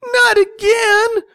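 very high, loud wail; drawn-out high shriek; mother's dismayed cry. A narrator's reading. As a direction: cry out slowly in exasperated alarm. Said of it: "Mike's mother cried".

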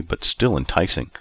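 ordinary delivery

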